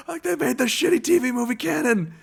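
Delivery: high, loud, excited fan squeal; giddy disbelief. Pretend. Fan marveling that the bad TV movie is canon: high excited gush. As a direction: gush like a thrilled superfan.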